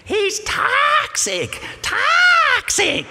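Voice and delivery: very high, loud, slow squealed toxic. Retold or imitated. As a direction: drag it out high and loud, almost shrieking. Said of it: "I can imitate that voice".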